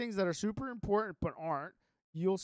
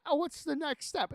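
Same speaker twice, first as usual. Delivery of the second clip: eager question; 8 semitones higher, 4 dB louder than usual. Retold or imitated. Retelling the AI's eager prompts.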